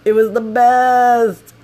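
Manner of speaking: high, loud, stretched-out delight; gleeful exclamation. Real, not acted. Storyteller relishing the rescue by locals, loud drawn-out glee. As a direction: belt it out slowly, savoring BEST. Gleeful climax, then repeated plainly.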